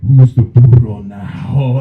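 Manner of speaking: loud, slow mocking taunt; loud slow mock gloat; triumphant taunt. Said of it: "And I go".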